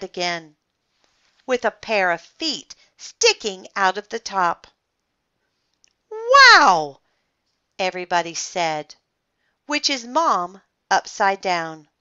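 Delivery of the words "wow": high, loud exclamation of awe; wowed outcry; amazed. A narrator's reading. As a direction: gasp it out loudly, astonished. Crowd amazed at the crash.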